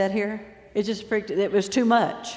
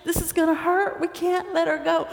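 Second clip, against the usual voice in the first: panicked helpers; 7 semitones higher than usual. Retold or imitated. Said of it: "And they're like".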